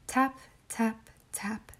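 soft tapping. Acted. Girl knocks softly.

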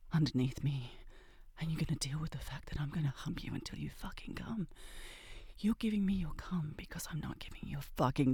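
low, intimate breathy whisper; seductive whisper. Acted. She whispers demands to her lover. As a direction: whisper it close to the ear.

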